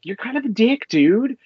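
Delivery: a hint of a jokey insult; mock jab. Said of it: "he's like".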